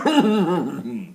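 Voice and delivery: chuckle. Acted. Tree laughs at young tree.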